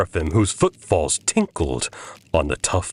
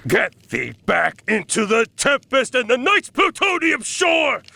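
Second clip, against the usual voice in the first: very high shouted command; shrieking. Acted. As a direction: throw him out with a scream. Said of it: "I shrieked".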